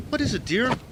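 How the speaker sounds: a smiling mother answering her son; sweet mother. A narrator's reading. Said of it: "She smiled at me".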